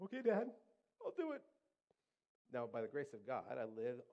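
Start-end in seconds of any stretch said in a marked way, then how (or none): 0.0-1.4 very high, loud mock-tearful boy; hammed-up child's surrender; reluctant kid. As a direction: give in to dad in a squeaky voice. Boy reluctantly obeys his father.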